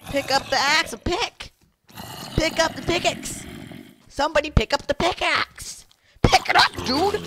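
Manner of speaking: high, loud repeated order; strained high-pitched shouting; frustrated yelling. Real, not acted. Yelling repeated order about pickaxe.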